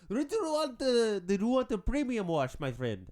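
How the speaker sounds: put-on amateur comic Indian accent. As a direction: put on a high Indian salesman's voice. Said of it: "I don't know why he's Indian".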